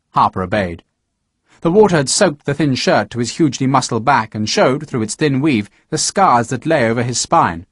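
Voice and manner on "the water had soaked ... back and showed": ordinary delivery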